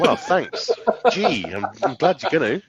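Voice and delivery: laughing, hammed-up gratitude; laughing mock thanks. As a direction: giggle through a fake thank-you. Mock grateful reply, laughing.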